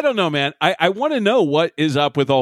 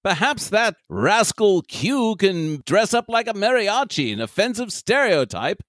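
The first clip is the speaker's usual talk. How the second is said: put-on British accent. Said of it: "some British douchebag".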